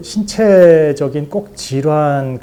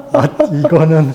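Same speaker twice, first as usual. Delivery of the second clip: higher, louder, talking through laughter; real amusement breaking into the words; laughing; 3 semitones higher, 5 dB louder than usual. Real, not acted. Laughing at prison equals marriage score. (웃음 섞인 높고 큰 목소리)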